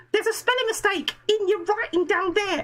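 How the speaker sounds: high mock wife voice; imitating his wife. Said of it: "she said".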